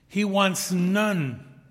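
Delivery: slow pleading stress; slow emphasis. Acted. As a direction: stretch each word to drive it home. Emphatic plea to listeners.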